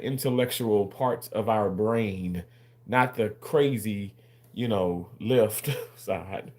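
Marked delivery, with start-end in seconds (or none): none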